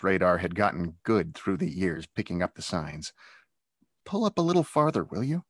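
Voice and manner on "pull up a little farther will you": high, quick and nervous; a little quaver. Acted. Nervous boy asking his stepmother to drive on, quavering. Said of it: "with a little quaver to his voice".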